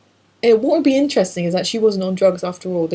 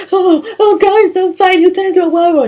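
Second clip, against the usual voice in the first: very high, loud damsel imitation; hammed-up high-pitched begging through amusement; mock panicked plea; 13 semitones higher, 7 dB louder than usual. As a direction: plead with two fighters in a squeaky put-on voice. Voices woman pleading against a fight.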